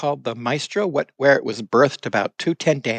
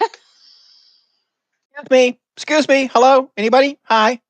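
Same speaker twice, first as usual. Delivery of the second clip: calling out; 13 semitones higher, 5 dB louder, much slower than usual. Acted. Calling out to a crowded room.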